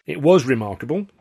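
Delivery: loud, slightly high concession; conceding. A narrator's reading. Company manager admitting the trick worked, conceding. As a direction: concede it with emphasis. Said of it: "Alf conceded".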